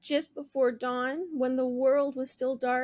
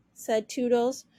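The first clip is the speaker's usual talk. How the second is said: ordinary delivery, nothing marked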